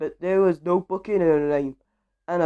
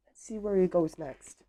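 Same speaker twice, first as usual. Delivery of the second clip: plain reading voice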